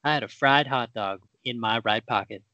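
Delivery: ordinary talk, nothing marked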